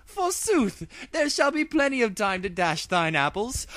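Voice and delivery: high, olde-English regal voice; archaic royal proclamation. Acted. Luna announcing to the two ponies their fate, regal. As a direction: pronounce it like a medieval monarch.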